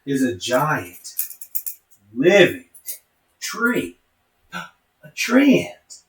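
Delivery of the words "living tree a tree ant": dramatic reveal. A narrator's reading.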